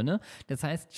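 ordinary talk

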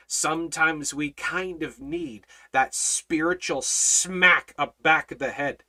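normal talking voice

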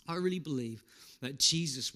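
normal talking voice

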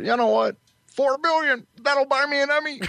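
high mock George voice; a quick high put-on George; imitating George. George imagined thinking aloud.

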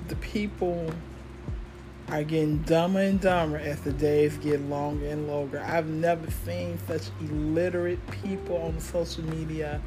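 normal talking voice

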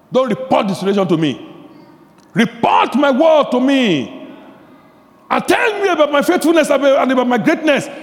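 loud, high, driving sermon voice; preaching at full force. Acted. Pastor rousing the congregation, voicing Jesus: full-force preaching. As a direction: hammer it home to the congregation.

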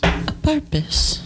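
high, slow James Charles impression. Shown as a demonstration. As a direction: lift the pitch like James Charles. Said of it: "James Charles".

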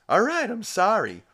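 high, loud whine of protest; exasperated mock apology. [name] as the judge adds years, loud exasperated apology.

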